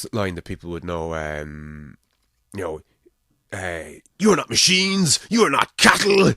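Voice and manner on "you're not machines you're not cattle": high, loud, slow rousing oratory; Great Dictator speech. Acted. Podcaster reenacting Chaplin's speech, rousing and slow. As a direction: stretch it out like an impassioned public speech. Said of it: "I'm gonna reenact the".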